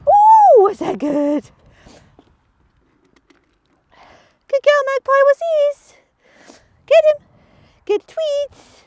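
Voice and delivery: very high, loud sing-song praise; excited praise. Real, not acted. Trainer gushing praise at Magpie after the beep, very high. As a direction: gush over the dog.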